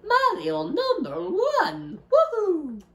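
high, slow cartoon plumber voice with laugh; Mario voice. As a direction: cheer it like Mario, squeaky high. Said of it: "Sounds horrible".

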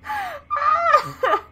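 high, loud cry of fright; real frightened wail. Real, not acted. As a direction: wail after a jump scare. Still shaken by jump scare.

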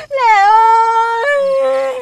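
high, loud, very slow tearful wail; a hammed-up crying farewell to her husband. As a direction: sob the call out long and loud. Parting lovers, wailing.

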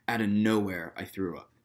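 ordinary delivery

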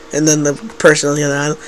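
ordinary talk, nothing marked

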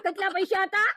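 usual speaking voice

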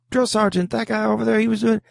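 high, sheepish recruit excuse; silly excuse voice. Retold or imitated. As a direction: whine out a lame excuse. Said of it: "I came back with something silly like".

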